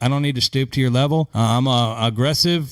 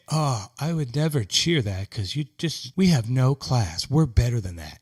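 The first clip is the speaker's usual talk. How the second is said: softer, prim mock of a snooty fan; 3 semitones lower, 4 dB quieter than usual. Mocking Vegas fans who say they have class, in a prim softer voice. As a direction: sniff with lightly mocked superiority. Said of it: "Or they say".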